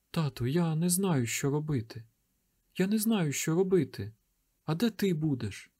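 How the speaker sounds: put-on scared boy, pitched high; child near tears, hushed; frightened boy. A narrator's reading. Terrified boy pleads.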